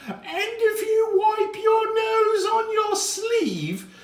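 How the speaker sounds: very high, loud, slow scolding schoolmistress; shrill strict teacher warning; prim schoolmarm. Acted.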